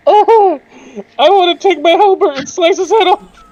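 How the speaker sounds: high, loud battle declaration; fired-up outburst. Acted. Player declaring a halberd attack on Kobe, fired-up shout. Announcing sudden attack on kobold.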